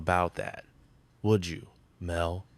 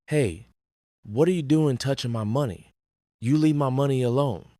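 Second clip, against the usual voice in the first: higher-pitched, protective outburst; indignant warning; 5 semitones higher, 3 dB louder than usual. Acted. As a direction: warn them off, voice jumping up. Warns someone off his money.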